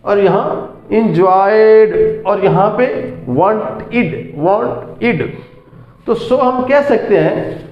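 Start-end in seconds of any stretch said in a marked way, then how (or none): 0.9-2.2 slow एंजॉयड gliding into D; smooth D ending. Shown as a demonstration. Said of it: "यहाँ smoothly डी". 3.3-5.4 slow वांटेड split as "want-id"; "id" ending. Shown as a demonstration. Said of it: "यहाँ इड की आवाज़ आएगी".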